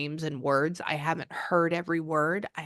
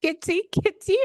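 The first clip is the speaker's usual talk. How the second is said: high, loud kitty-calling voice; cooing to a cat; 8 semitones higher, 5 dB louder than usual. Retold or imitated. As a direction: imitate someone greeting a cat. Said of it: "And then she goes".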